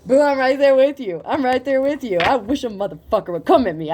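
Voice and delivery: rallying behind a friend; hyped solidarity; fired-up support. Real, not acted. Cheering the person on.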